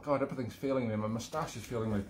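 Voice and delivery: ordinary delivery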